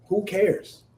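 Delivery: ordinary talk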